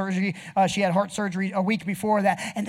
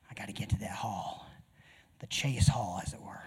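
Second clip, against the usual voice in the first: whispered aside; 11 semitones lower, 9 dB quieter than usual. Acted. Whispered aside about the hallway.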